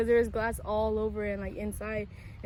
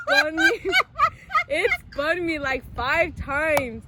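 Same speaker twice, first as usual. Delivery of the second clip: high and loud, laughing between words; laughing through it; 4 semitones higher, 11 dB louder, slower than usual. Real, not acted. Describing car spinning five times, laughing hard. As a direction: crack up recounting the spin.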